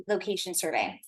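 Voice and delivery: ordinary talk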